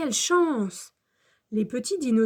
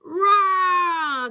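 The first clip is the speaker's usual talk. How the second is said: loud, high, falling roar; cri de Nino Dino; 6 semitones higher, 7 dB louder than usual. A narrator's reading. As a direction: let out a small dinosaur's cry. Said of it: "crie Nino Dino". (cri aigu de petit dinosaure)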